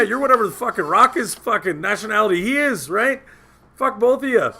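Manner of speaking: high-pitched indignant mock rant; retold rant. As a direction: re-enact cursing out both friends. Retold argument continues.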